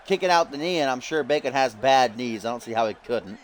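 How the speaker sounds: normal talking voice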